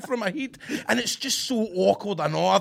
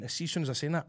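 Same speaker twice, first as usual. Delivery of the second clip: usual speaking voice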